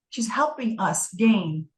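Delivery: ordinary talk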